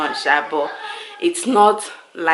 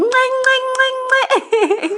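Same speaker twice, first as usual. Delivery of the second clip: kissing noises. Real, not acted. Mother kissing her freshly bathed baby, smacking kisses.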